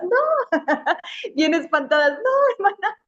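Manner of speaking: very high, shocked cries; mock shocked girls. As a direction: gasp it like startled girls. Las muchachas espantadas responden. (chicas espantadas, voz muy aguda)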